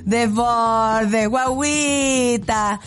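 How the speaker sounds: long drawn-out cooing protest; mock pity. Real, not acted. Host teasing a politician as a little baby, mock pity.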